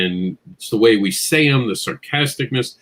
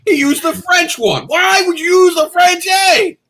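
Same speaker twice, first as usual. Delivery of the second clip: much higher and louder, slow; mock outrage; 6 dB louder, slower than usual. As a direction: play up the outrage for laughs. Mock outrage at friend's typo.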